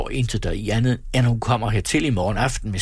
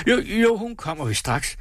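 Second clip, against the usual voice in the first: high, hurried-sounding reassurance; flustered assurance; 10 semitones higher than usual. A narrator's reading. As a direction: cover up with a high-pitched yes. Flustered husband covering up.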